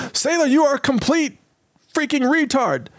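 high mock officer voice; mock navy officer. Host plays an officer insulting a recruit in a high mock voice.

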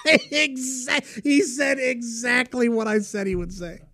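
high, laughter-squeezed voice; laughing. Real, not acted. Storyteller cracking up at the doorman's reply, laughing. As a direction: talk through a real fit of laughter.

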